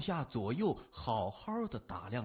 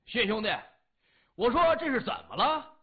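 higher, louder booming call from afar; big shout; 8 semitones higher, 7 dB louder than usual. A narrator's reading. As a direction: roar it across the yard. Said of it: "一声大吼".

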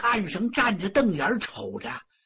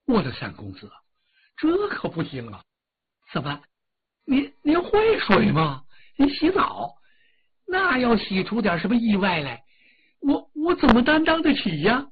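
frightened pleading; 5 semitones higher than usual. Acted. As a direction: panicky old steward, voice jumping higher and stammering. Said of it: "可把管家李兴吓坏了".

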